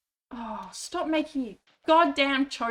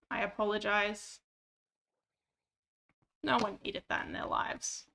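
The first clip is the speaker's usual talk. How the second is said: ordinary talk, nothing marked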